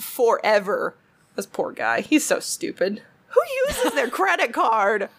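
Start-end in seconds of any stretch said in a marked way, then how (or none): 3.3-5.1 high, laughing disbelief; incredulous laughing. Real, not acted. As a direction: laugh through the question at his stupidity. Mocking the stupid scammer.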